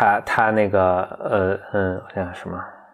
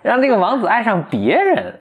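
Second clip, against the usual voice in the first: 让这个王子爱上别人 higher and slow, hammed up; cartoon dub voice; 9 semitones higher than usual. After co-host's praise, repeating the dubbed line higher. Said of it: "你学这声音特像".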